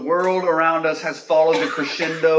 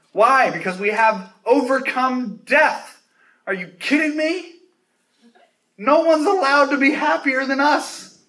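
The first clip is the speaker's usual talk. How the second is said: higher, rising preacher's exclamation; excited; 9 semitones higher than usual. Real, not acted. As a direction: throw it out, thrilled and incredulous.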